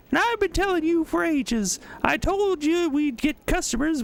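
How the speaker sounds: very high, braying scold to her husband; shrill-pitched comic partner scolding her dolt; braying woman. Acted. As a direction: bray it at him like a nagging wife. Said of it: "braying voice".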